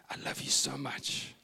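slow, breath-only whisper; whispering child. Retold or imitated. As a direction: whisper a loving confidence. Son confides to dad in bed.

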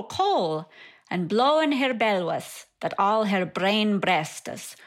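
reading a poem aloud in Middle English pronunciation. Shown as a demonstration. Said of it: "so I'll read it in Middle English first".

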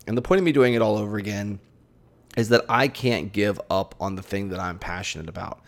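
ordinary delivery

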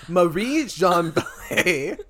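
loud name slowly pushed through laughter; cracking up. Real, not acted. Host struggling to read the story, cracking up. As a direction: try to read it out while laughing.

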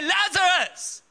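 booming call to Lazarus; commanding call. Acted. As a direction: summon the dead man with authority. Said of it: "He had to say".

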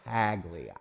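PAGLIA with the G sounded, PAG-lee-a, low; outsiders' pronunciation. Shown as a demonstration. Showing how outsiders say it, Paglia as PAG-lee-a. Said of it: "people who aren't from around here pronounce it".

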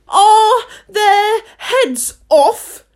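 very slow, high shriek; drawn-out imperious scream; shouted question. A narrator's reading. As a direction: shout it word by word as the queen. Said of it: "shouted the queen".

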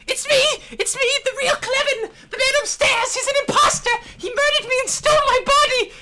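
very high, shrieking, panicked scream; frantic scream. Acted. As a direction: shriek in terror at the top of your lungs. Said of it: "before screaming".

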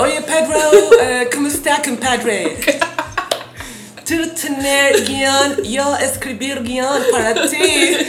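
slow, halting foreigner's Spanish, mock eager; hammed-up actor pitching himself in clumsy Spanish; Ethan Hawke in broken Spanish. Actor begging Almodóvar for work, slow broken mock Spanish. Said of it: "Él quiere puro trabajar más con Almodóvar".

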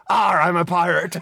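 slow hammed-up pirate cry; mock pirate shout, drawn out; pirate yell. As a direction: ham up a pirate at climax. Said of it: "you yelled out".

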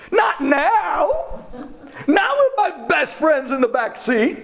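high-pitched, incredulous wail; hammed-up teenage despair, pleading with heaven; anguished protest. Acted protest to God at breakdown.

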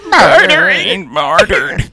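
high, slow old man imitation; very old faint voice. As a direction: croak it like a creaky old man. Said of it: "you sounded like that old man".

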